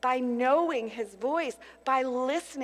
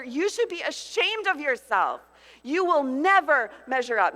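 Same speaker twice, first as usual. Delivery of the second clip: louder, shaming voice building to a hammering peak; accusing inner voice; 4 dB louder than usual. Acted. Preacher voicing the enemy telling a mom she fails, loud and shaming. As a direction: pile on the shame line by line.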